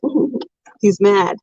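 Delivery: normal talking voice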